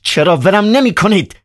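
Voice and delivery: high, rude, dismissive retort; disrespectful. Acted. Ivan rudely brushing off the doctor, high and insolent. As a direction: snap it back contemptuously, pitched high. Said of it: "با بی احترامی جواب داد".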